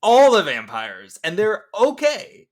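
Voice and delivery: rising to a loud, high incredulous rant; exasperated disbelief. Retold or imitated. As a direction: build from baffled to fed-up outrage. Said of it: "And I was just like".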